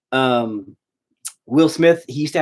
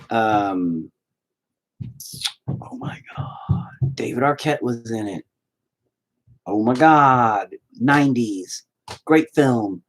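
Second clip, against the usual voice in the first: ordinary talk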